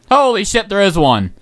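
ordinary delivery

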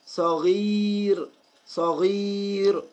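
صغير slow, with the ee after غ held long; slow teaching model of صغير; long kasra. Shown as a demonstration. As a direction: stretch the ee in صغير. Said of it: "يعني كسرة طويلة".

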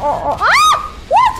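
sudden high scream; startled scream. Real, not acted. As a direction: scream in fright. Startled by monster in game.

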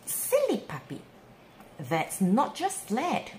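usual speaking voice

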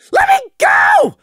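shrill, very high, loud squeal; high-pitched outraged shriek. Acted. Prey thrashing while carried.

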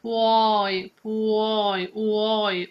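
"puoi" with U, O, I each heard; three vowels. Shown as a demonstration. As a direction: open out U O I in "puoi". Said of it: "we need to hear the three".

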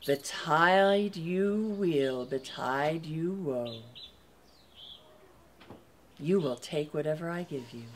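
slow, low and soft like a spell; solemn pronouncement. Acted. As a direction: intone it like an ancient blessing. Queen's solemn, final pronouncement.

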